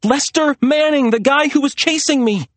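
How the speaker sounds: high, urgent insistence; frantic explanation. A narrator's reading. Maria explaining her pursuer to Serena, frantic. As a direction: make them understand the danger.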